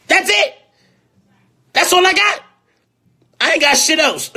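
very high-pitched, incredulous protest; high, loud, half-laughing plea; comic exasperation. Real, not acted. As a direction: squeal it out in helpless protest. Mock-helpless plea, laughing about himself.

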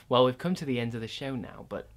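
low quiet sign-off; hushed host voice. Acted. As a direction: murmur the goodbye.

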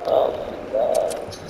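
very low, slow, whispered oh my God; drained disbelief under the breath; breathless whisper. Real, not acted. Gamer just killed, whispering in disbelief. Stunned after dying.

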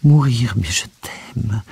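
distraught declaration of love; guttural voice. A narrator's reading. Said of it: "ajouta-t-elle d'une voix gutturale".

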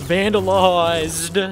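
slow, high, loud gleeful exclamation; drawn-out gleeful cry. Real, not acted. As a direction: stretch it out in delight. Gasps then drawn-out gleeful word.

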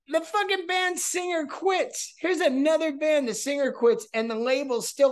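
high-pitched disbelief; incredulous. Real, not acted. Storyteller amazed that the singer quit, high and incredulous. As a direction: exclaim it high, amazed at the absurdity.

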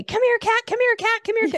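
very high, loud sing-song call; dog-style call at a cat. Shown as a demonstration. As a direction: shout for the cat like it's a puppy. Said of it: "you can't call it like you call a dog".

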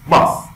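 "باس" burst out loudly; explosive B. Shown as a demonstration. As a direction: hit the b of "باس" hard.

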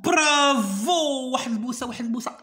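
excited, high-pitched congratulation; delighted praise. Real, not acted. Delighted praise for pupil's right answer.

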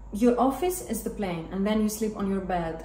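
usual speaking voice

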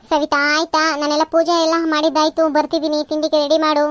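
loud household summons; calling out. Acted. Mother-in-law calling daughter-in-law to ready breakfast, loudly calling out. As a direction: call out loudly with an order. Same call heard again.

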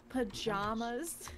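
PAJAMAS with a broad "jah" in the middle; drawn-out "puh-JAH-muhs", asked as a question; questioned pronunciation. Retold or imitated. Friend querying how the other says it, questioned pronunciation. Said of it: "how do you pronounce that?".

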